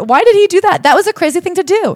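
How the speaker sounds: very high, exasperated disbelief; incredulous outrage. Real, not acted. Host defending the mom against his story, real incredulous outrage. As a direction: pitch shoots up, indignant at his behaviour.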